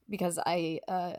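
usual speaking voice

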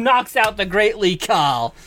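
normal talking voice

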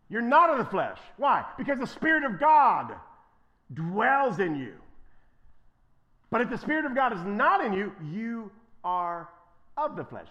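loud, high, pounding delivery; fervent preaching. Real, not acted. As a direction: drive it home to the church. Preaching at full force.

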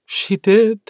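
forcing the words out through tears; choked with grief. A narrator's reading. Elizabeth to Ox about his mother, choking on grief. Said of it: "Elizabeth chokes out".

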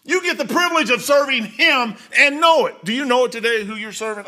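loud, high preaching build. Acted. Preacher urging the church at full force. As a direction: lift the congregation with force.

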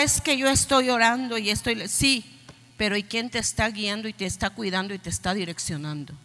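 usual speaking voice

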